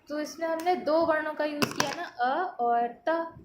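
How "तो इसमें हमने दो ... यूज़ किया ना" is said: normal talking voice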